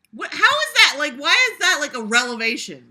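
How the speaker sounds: loud, high disbelief; loud high incredulous questioning. Real, not acted. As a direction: demand it in disbelief. Incredulous at the text.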